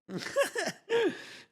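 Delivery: high rising giggle; giddy laugh. Real, not acted. Streamer laughing about renaming his stream.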